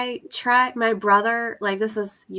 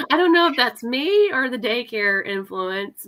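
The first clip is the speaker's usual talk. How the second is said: ordinary talk, nothing marked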